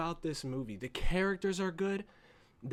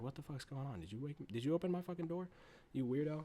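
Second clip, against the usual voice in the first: hushed scolding; 9 dB quieter, faster than usual. Retold or imitated. Tess waking Keith, soft annoyed scold. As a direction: scold him under your breath. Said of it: "she's like".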